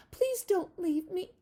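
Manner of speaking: high, slow, begging lamb; mild little lamb plea; pleading lamb. Acted. Lamb pleading in a read-aloud.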